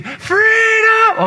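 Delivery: huge high shout, held long; Mel Gibson battle cry. Retold or imitated. Priest leading the crowd in a Braveheart cry, roaring. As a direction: scream it like a rebel warrior. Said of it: "a little bit like Mel Gibson".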